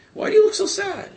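retold sympathetic prodding, pitched right up; concerned question. Katriel to the sad-looking brother, high-pitched concern.

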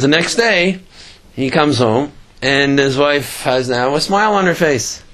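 usual speaking voice

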